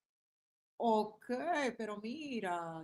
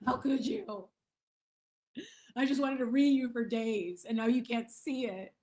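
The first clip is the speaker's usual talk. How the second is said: loud, hammed-up wounded protest; mock hurt outrage; 3 semitones higher, 7 dB louder than usual. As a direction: imitate a troll aghast at being blocked. Mimics wounded blocked troll.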